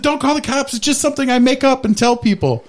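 mock high-pitched desperate confession; panicked stranger. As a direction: squeak it out, caught in a lie. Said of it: "she's like".

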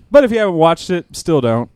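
ordinary delivery

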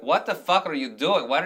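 high, loud, exasperated stage diva outburst; Hedwig snapping at the audience. Retold or imitated. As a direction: round on a chattering front row mid-show. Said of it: "Hedwig talks to the audience and be like".

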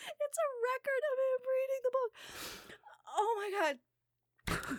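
high, slow, laughing through the words. Real, not acted. Delivering the record punchline to Jimmy, laughing helplessly. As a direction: squeak it out mid-laugh.

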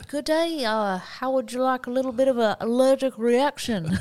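high, hammed-up Aussie voice; bad Australian accent. Cheese offering an allergic reaction, mock Australian accent. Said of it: "That's your worst accent".

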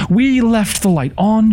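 high, drawn-out insistence; slow, high-pitched, fearful protest; cling to reason in a high, frightened voice; panicked reasoning. A narrator's reading. Panicked reasoning in terrified thought.

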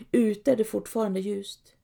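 usual speaking voice

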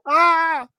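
high, sudden mock shriek; a jump-scare yelp; startled scream. Recalling the witch jump scare, high mock shriek. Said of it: "I was like".

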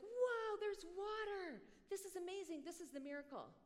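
put-on childlike awe at water in the desert; excited wow. Preacher voicing his childhood idea of Philip, amazed. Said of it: "he was like".

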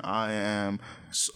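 normal talking voice

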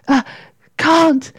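high, halting, spent protest; gasping. A narrator's reading. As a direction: struggle to get the word out. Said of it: "gasped Katy".

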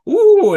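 high, loud "ooh"; imitated crowd "ooh". Conference crowd reacting to the screen, high mock ooh. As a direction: mimic an audience's sudden ooh. Retold audience reaction heard suddenly.

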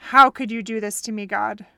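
loud, fast, bitter cry at heaven; shaking a fist at God, shout it; a wronged soldier rages at God; bitter outcry. Retold or imitated. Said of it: "he shook it at heaven and he said".

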